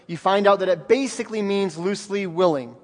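ordinary talk

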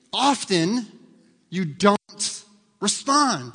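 drawn out, each word separate; slow hammering refrain; slow emphatic repeat. Shown as a demonstration. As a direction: stretch it out so it lands. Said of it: "Lock it up".

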